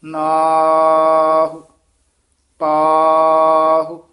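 ਨਾਹੁ and ਪਾਹੁ hugely drawn out and loud, short u at the end; practice drill pronunciation. Shown as a demonstration. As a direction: stretch the long aa in ਨਾਹੁ, ਪਾਹੁ. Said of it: "ਇਸ ਤਰ੍ਹਾਂ ਉਚਾਰਨ ਕਰਨਾ ਹੈ। ਮਿਹਨਤ ਵਾਸਤੇ".

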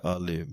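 ਆਲੇ said softly with a light l; ਆਲੇ ਪੋਲਾ; soft pronunciation. Shown as a demonstration. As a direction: let ਆਲੇ come out soft. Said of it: "ਇਹ ਪਾਠ ਵੀ ਪੋਲਾ ਹੈ".

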